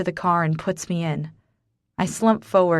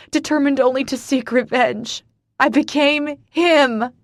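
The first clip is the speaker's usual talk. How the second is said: hysterical rant; 7 semitones higher, 4 dB louder than usual. Acted. Hysterical confession continues.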